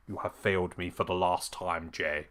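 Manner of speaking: understated, low villain threat; Roman Reigns's quiet fury. Retold or imitated. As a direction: imitate a boss's cold disappointment. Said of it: "Roman's furious with Jay".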